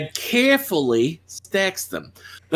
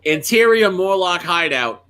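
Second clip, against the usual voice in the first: normal talking voice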